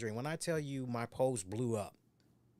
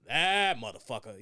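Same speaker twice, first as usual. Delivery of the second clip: anchor cursing off air; 9 dB louder than usual. Retold or imitated. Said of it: "Then Leon just goes".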